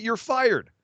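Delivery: high, shouted firing; retold shout. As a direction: yell it at him. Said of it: "screamed at him that".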